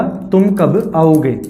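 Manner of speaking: तुम, कब, आओगे drawn out slowly, each word separate; spaced-out teacher's repeat of तुम / कब / आओगे. Shown as a demonstration. Repeats it to separate three words.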